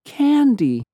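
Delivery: put-on high sweet childlike voice, plain; innocent girl voice. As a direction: repeat it simply like a small girl. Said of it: "she repeated plainly".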